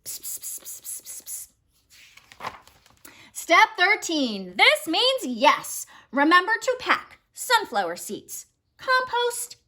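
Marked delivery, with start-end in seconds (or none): 0.0-2.5 whispered psst, unvoiced and soft. Whispering to parents. 3.3-5.9 slow and deliberate. Acted. Slowed for emphasis.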